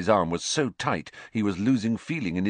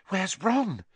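terrified; 10 semitones higher than usual. A narrator's reading. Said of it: "she whispered in a terrified voice".

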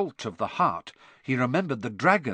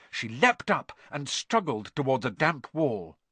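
usual speaking voice

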